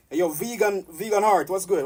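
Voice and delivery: loud, high greeting yell; hyped shout-out. Real, not acted. Greeting a viewer named vegan heart, loud and hyped. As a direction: call out to the viewer with big energy.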